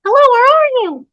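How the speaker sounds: very high, loud squeaky mimic; toy echo. Toy mimics the searching woman, squeaky echo. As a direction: parrot the call like a talking toy.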